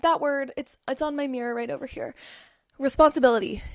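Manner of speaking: normal talking voice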